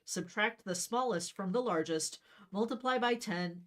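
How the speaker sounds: ordinary talk, nothing marked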